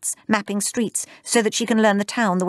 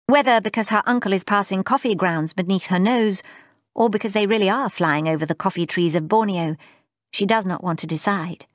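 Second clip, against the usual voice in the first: ordinary delivery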